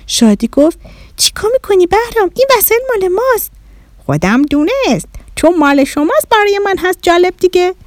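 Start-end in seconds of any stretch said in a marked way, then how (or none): 1.2-3.5 put-on high-pitched, quick child's objection; alarmed protest. Shadi stopping Bahram wrecking their things, alarmed protest. 4.0-7.7 put-on high alien child, blithe; little Martian boy. Bahram shrugging off the children's protest, little Martian voice.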